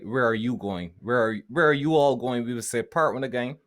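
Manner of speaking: normal talking voice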